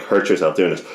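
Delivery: ordinary talk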